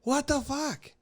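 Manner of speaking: high, soft, mock-baffled; baffled viewer. Imitating the confused audience, high mock disbelief. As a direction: throw it up as a stunned viewer.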